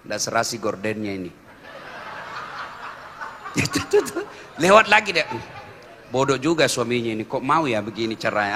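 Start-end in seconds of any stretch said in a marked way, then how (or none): 0.1-1.3 low, soft disapproving mutter; inner grumble. Retold or imitated. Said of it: "dalam hatinya". 5.3-8.5 soft, low scornful aside; snide inner voice. Retold or imitated. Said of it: "dalam hatinya".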